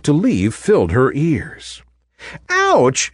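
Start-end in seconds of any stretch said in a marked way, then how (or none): 2.5-3.1 sudden high yelp of pain; a sharp, high "Ouch!" as she is bumped; cry of pain. Acted. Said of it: "she cried".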